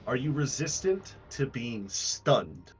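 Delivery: ordinary talk, nothing marked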